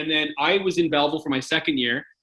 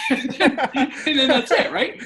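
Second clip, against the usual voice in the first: laughing; 11 semitones higher, much slower than usual. Real, not acted. Laughing at his own story.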